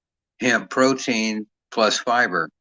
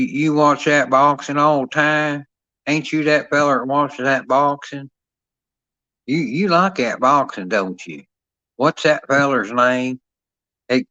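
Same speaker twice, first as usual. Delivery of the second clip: higher-pitched imitated Southern country talk; 3 semitones higher than usual. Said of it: "That's how they talk down here".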